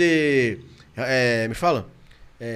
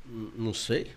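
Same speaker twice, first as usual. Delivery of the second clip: sheepish mumble; 9 semitones lower, 10 dB quieter, slower than usual. Retold or imitated. Caught without answer to wife.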